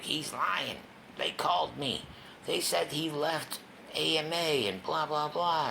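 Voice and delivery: hammed-up angry stepmom; stepmom on the phone. Stepmom voiced angrily in story.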